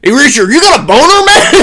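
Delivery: fast, high, loud New York tease; New York accent. Retold or imitated. Cousin teasing young Richard, in a fast loud New York accent. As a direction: needle him like a crude New York cousin. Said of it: "I made him New York".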